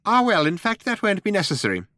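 high little mouse voice; officious mouse. A narrator's reading. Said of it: "said Frankie Mouse".

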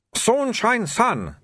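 high, slow and insistent; shopkeeper Soon's accent. Acted. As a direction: correct the name slowly and carefully. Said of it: "said Mister Soon".

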